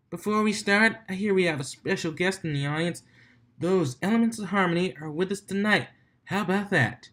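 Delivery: high announcer welcome; DJ emcee. Acted. DJ greeting the Elements of Harmony, excited. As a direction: show off the special guests.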